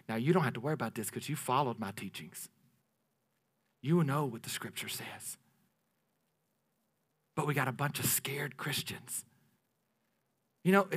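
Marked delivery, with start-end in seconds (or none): 0.1-5.4 quiet reassurance. Retold or imitated. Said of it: "he told Timothy". 7.4-9.3 whispered aside, hushed and low. Real, not acted. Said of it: "hushed remark".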